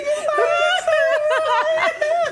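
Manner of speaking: high cry-laugh shriek; helpless high-pitched howl of laughter; laughing until it sounds like crying. Real, not acted. Cracking up retelling story.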